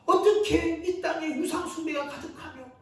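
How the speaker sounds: prophet's indignant protest, pitched high and loud; protesting to God. Acted. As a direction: argue with God, building to a shout. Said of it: "하나님께 이렇게 따집니다".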